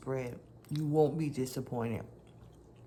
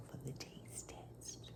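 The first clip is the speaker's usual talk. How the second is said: whispering; 3 semitones lower, 19 dB quieter than usual. Real, not acted.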